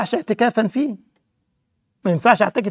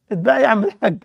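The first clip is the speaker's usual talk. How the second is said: amused mock retort. Mock reply, laughing.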